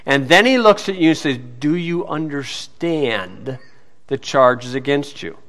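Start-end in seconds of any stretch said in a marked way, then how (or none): 1.6-5.4 slow, low judge's question, softly put; imitated solemn judge. Judge to defendant after reading the charges, solemn. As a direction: put it slowly and quietly, from the bench.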